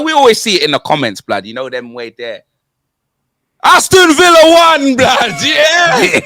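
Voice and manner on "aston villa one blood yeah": shouted out high and loud like a goal call; gloating shout. Acted. Rubbing the score in to Arsenal fans, shouted. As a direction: bellow the scoreline to rub it in. Gloating over the Villa win.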